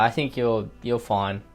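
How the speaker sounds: usual speaking voice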